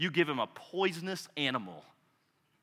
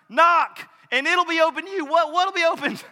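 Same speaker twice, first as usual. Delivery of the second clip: preaching shout; 12 semitones higher, 8 dB louder than usual. Acted.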